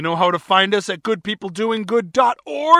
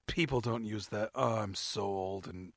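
sheepish whisper; 13 semitones lower, 15 dB quieter than usual. Real, not acted. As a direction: drop to a soft, sheepish whisper. Mocking himself for being old.

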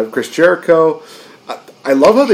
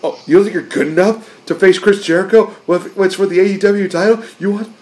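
high, mocking Jericho voice; 7 semitones higher than usual. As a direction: sneer it like an offended champion. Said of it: "he's just like".

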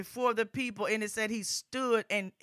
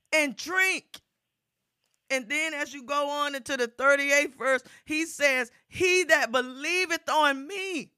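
rising, louder gospel preaching, driving each phrase; full-force preaching; 5 semitones higher, 6 dB louder than usual. Acted. Preacher urging the congregation, full-force preaching. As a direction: preach it out to the whole congregation, building.

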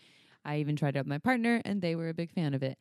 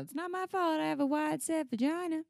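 movie quote; 9 semitones higher than usual. Retold or imitated. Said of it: "It's from a movie".

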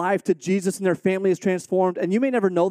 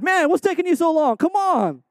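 high, taunting call back down the tunnel; mock-impatient coaxing; teasing teammates; 9 semitones higher than usual. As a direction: needle the big guys to hurry. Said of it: "I'm looking back like".

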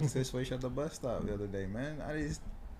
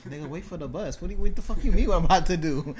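retold exasperation, rising higher and louder; indignant retort; 6 semitones higher, 7 dB louder than usual. Retelling his comeback to a nosy stranger at the bus stop, mock indignant. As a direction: throw it back, incredulous, building to louder.

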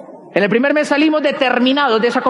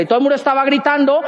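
ordinary delivery

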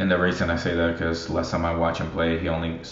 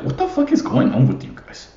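much higher, squeaky, pleading; mock high-voiced teammate demanding answers; exasperated locker-room pep talk. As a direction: rise way up into a squeak as if begging the team to talk. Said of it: "I'll be like".

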